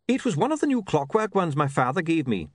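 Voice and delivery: high-pitched, earnest little man; earnest watch loser. Acted. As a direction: explain the loss in a high voice.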